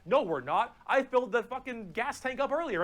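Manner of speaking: mock-indignant orc comeback; indignant big orc. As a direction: argue back as the cocksure orc boss. Acts out big orc arguing back.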